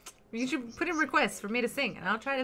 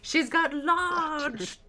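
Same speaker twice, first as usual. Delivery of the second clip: very slow, louder put-on voice; Monty Python quote; 6 semitones higher, 6 dB louder, much slower than usual. Streamer quotes a favorite Monty Python line, slow and louder. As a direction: drag it out like a smug nobleman. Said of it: "I like the ones where he's like".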